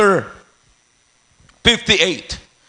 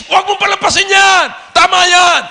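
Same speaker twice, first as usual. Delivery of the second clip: high, insistent full-force exhortation; shouted preaching; 7 semitones higher, 4 dB louder than usual. Real, not acted. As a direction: keep shouting to rouse the sleepers. Preacher shouting at dozing members.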